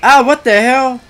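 loud, high outraged yell; exasperated shout. Real, not acted. Player losing control of a fight, exasperated shout. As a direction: shout it in sudden frustration. Reacts to fight going wrong.

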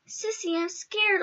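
put-on high, soft, slow small child; a frightened kid tugging at his sister; scared little boy. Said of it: "he's like".

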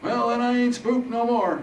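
put-on high, loud old man, amused; chuckling bravado. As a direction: crow it cheekily as Harold. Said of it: "Harold chuckled".

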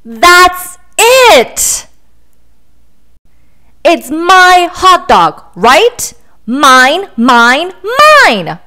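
loud, slow, stomping possessive outburst; pigeon tantrum. Acted. Pigeon yelling at the duckling that the hot dog is his, loud tantrum. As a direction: shout it like a toddler who won't share.

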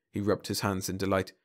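usual speaking voice

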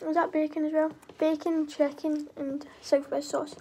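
talking between bites; chewing while speaking; mouth full of sandwich. Real, not acted. Seen 0: Sandwich held at mouth, bitten, mouth working while speaking.

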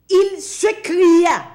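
loud, high, slowed cry; drawn-out preacher's cry. Acted. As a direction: stretch the words out like an anguished cry. Preacher dramatizes the rich man's cry.

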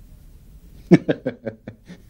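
awkward laugh. Acted.

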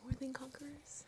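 breathy unvoiced prompt; feed him the title under your breath; whisper. Real, not acted. Host whispering the book title as a prompt.

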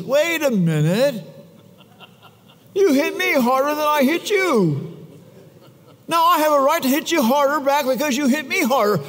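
put-on high, outraged child's protest; the other kid answering back, high and indignant. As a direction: protest like the kid who got hit harder.

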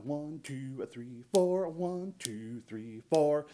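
"two" and "four" leaned on in a walking count; walking bass count. Shown as a demonstration. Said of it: "still gonna be emphasizing beats two and four".

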